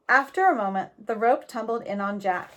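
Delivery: plain reading voice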